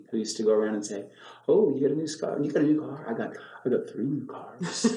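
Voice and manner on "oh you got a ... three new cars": lightly imitated one-upping braggart, a little higher at first; playful mock boasting, tailing into a laugh; Penelope. Mimicking a comedy character who brags, lightly mock boastful. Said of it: "that person from Saturday Night Live, Penelope".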